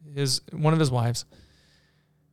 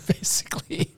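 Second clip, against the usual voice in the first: whispered through a laugh; whispered chuckle; 6 dB quieter than usual. Real, not acted. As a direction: breathe it out laughing. Laughing aside after quoting order.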